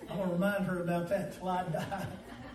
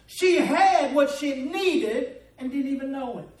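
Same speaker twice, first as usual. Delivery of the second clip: raised, driving pulpit delivery; impassioned preaching; 3 semitones higher, 5 dB louder than usual. Acted. Preacher describing desperate crying prayer, impassioned preaching. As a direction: preach it urgently, pitch held up. Preaches the widow's story forcefully.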